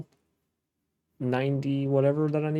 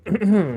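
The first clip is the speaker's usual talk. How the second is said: throat clear; 7 semitones higher, 7 dB louder than usual. Real, not acted. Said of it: "excuse me".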